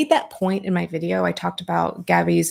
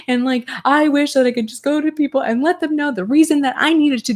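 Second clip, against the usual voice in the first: mock crying quote; 6 semitones higher than usual. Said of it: "like, crying, and she was like".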